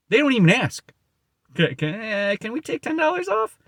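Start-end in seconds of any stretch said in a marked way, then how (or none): 1.5-3.6 much higher, softer, timid little voice; meek timid buyer. Retold or imitated. A buyer standing at his door, afraid of him, asking a small discount in a timid, much higher, softer voice. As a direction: shrink into a scared buyer begging politely.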